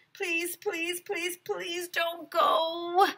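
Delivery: high, slow, whiny pleading; boy begging the teacher not to leave. A narrator's reading. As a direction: beg with a tearful whine. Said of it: "I begged".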